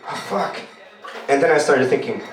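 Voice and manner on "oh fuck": muttered oh fuck. Retold or imitated. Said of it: "I was like".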